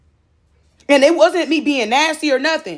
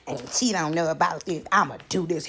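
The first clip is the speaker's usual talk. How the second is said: sly low murmur; 10 semitones lower, 9 dB quieter than usual. Retold or imitated. Voicing scheming man's thoughts.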